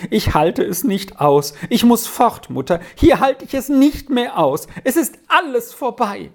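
high, despairing outburst; sobbing. A narrator's reading. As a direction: cry out that you can't bear it. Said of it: "schluchzte weiter".

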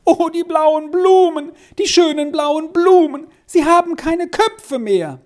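put-on very high, sustained childlike outcry; child's wail. A narrator's reading. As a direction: wail over the ruined flowers like a little child. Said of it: "Squirrel schrie noch einmal auf". (aufschreiend, klagend)

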